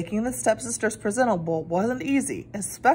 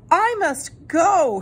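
higher, louder and drawn out slowly; urgent cry; 8 semitones higher, 5 dB louder, much slower than usual. A narrator's reading. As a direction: call out in alarm as the clock strikes. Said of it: "she cried".

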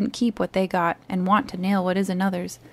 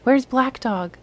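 higher-pitched, mild cry of alarm; 5 semitones higher, 4 dB louder, slower than usual. A narrator's reading. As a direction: call it out with a sudden rise in pitch.

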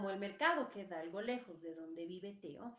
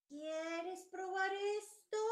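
put-on old vendor; 11 semitones higher, slower than usual. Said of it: "dice la anciana vendedora".

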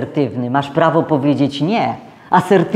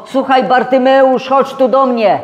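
raised, higher calling voice; 8 semitones higher, 3 dB louder than usual. Acted. Jesus calling Bartimaeus over, raised calling voice. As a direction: call him over from a distance. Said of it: "zawołał go".

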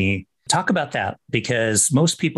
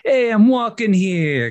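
put-on brash, much higher and louder New York street accent; 10 semitones higher, 4 dB louder, slower than usual. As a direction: belt it like a Queens cabbie, New York accent. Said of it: "kind of accent".

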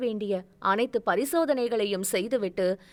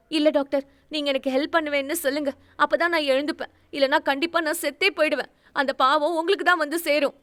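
higher, fast, urgent threat; desperate threat; 5 semitones higher, faster than usual. Acted. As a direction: press her with guilt, voice raised high. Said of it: "மிரட்டல் விடுத்தாள்". (உயர்ந்த குரலில் அவசர மிரட்டல்)

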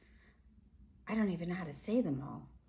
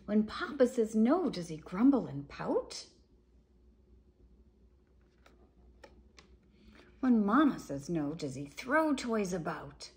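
louder, animated picture-book reading; reading to children; 2 semitones higher, 7 dB louder than usual. A narrator's reading. Reading a dinosaur book to children, animated and louder. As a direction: boom out the parents' no like a storyteller.

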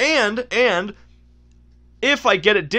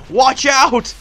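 louder, higher acted alarm; game character warning; 5 semitones higher, 5 dB louder than usual. Character warning that the essences are unstable, alarmed. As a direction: call out a sudden cartoon warning. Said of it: "now I'm gonna do the voices".

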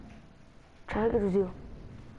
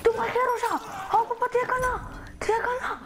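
much higher, hammed-up falsetto panic; mock horrified squeal; 13 semitones higher than usual. Gamer pretending to be shocked after knifing an enemy, high squeal. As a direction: fake shock at your own kill.

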